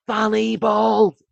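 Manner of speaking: high, drawn-out imitation yell; full-volume yell. Drunk Glaswegians daring each other to yell in strangers' faces, full-volume yell. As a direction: bellow it as loud as you can, stretching it out. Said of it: "as loud as they could in their faces".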